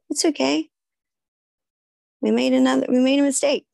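high-pitched, soothing reassurance; acted self-reassurance. Compassion instead of beating ourselves up, high reassuring voice. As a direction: comfort yourself as you would a little child.

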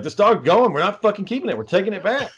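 high, heated retold argument; re-enacting an exasperated final word; angry ultimatum. Acted quarrel, wife crying.

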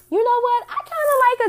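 suddenly high and loud; excited burst. Real, not acted. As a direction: burst out with a new thought. Sudden excited aside to viewers.

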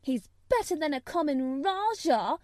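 heated retort. A narrator's reading. Mary retorting to Colin, heated. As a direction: fire the insult back. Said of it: "retorted Mary".

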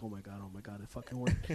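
stunned quiet relief under breath; hushed relief. Retold or imitated. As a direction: murmur it to yourself, barely audible. Relief after passing security.

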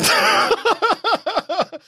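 high-pitched burst of laughter. Real, not acted. Laughs at the question.

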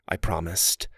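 plain reading voice

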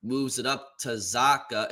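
slow, stretched announcer delivery; drawn-out slow call. Retold or imitated. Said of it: "why are you talking like that?".

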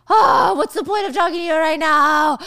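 high, loud exasperated outburst; fed-up Michael. Retold or imitated. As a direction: blow up at her.

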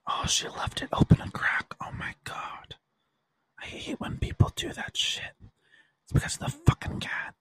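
hushed annoyed whisper; whispered aside. Real, not acted. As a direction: grumble to yourself so no one hears. Muttering aside about the open door.

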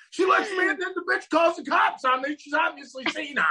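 high, shouted, hammed-up rant; mock outraged Jerry. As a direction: ham up Jerry's indignation for laughs.

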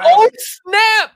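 high, loud delighted exclamation; excited. Real, not acted. Seeing his cohost's cat on camera, excited. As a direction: burst out in delight. Sees the cats arrive.

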